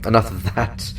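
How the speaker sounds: ordinary talk, nothing marked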